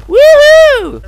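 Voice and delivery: loud falsetto woohoo; high whoop. Real, not acted. Player whooping during the game, high and loud. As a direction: whoop at the top of the voice.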